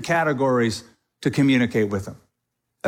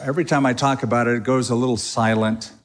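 ordinary talk

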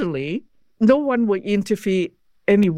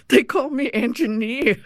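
real laugh into the words; amused; 4 semitones higher than usual. Real, not acted. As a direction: admit it with a laugh, pitched higher. Amused admission of her nickname.

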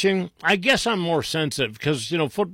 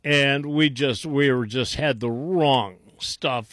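ordinary talk, nothing marked

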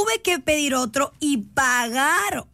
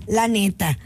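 normal talking voice

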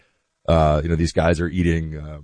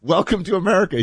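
cheery welcome; 11 semitones higher than usual. Retold or imitated. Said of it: "It's like".

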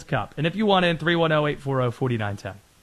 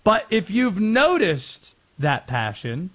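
ordinary delivery

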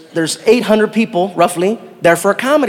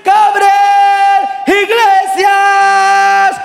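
high, shouted, drawn-out announcer call; big announcer introduction; 12 semitones higher, 3 dB louder, much slower than usual. Retold or imitated. Hype man introducing comic to crowd, huge shouted announcement. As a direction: hype man stretching every word to the rafters. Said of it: "biggest introduction of my life".

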